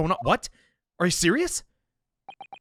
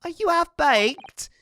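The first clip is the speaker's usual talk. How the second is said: high, slow mocking disbelief; incredulous Gina; 4 dB louder, much slower than usual. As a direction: scoff it out, way up high. Gina scoffs at the lawyer.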